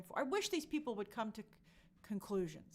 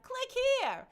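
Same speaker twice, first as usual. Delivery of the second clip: high, loud mock come-on; promotional mimicry; 13 semitones higher, 8 dB louder than usual. Host mimics the announcement inviting clicks, high mock-promotional call. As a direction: chirp it loudly like a hammed-up advert. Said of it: "they were like".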